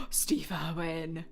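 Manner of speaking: excited whisper. Real, not acted. Revealing her ghost pick to her co-host, slow whisper. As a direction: gasp then slow hushed whisper.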